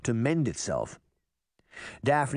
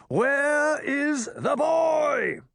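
slow, high-pitched roar; furious drawn-out bellow; bellowed demand; 4 dB louder, much slower than usual. A narrator's reading. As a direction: boom it over a crowd like an angry cloud. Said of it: "a familiar voice bellowed a demand".

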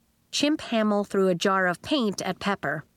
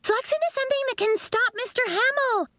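much higher, fast urging; girl urging classmates to transform; urgent girl; faster than usual. A narrator's reading. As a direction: rush the order out. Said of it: "One of the girls said".

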